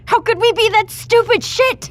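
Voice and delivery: high, loud furious cry; exasperated outburst. Acted. Doctor raging at the council's blunder, loud and high. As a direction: explode at your own stupidity. Doctor despairs over lost contact.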